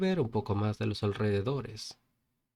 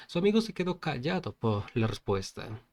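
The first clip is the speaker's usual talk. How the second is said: ordinary delivery, nothing marked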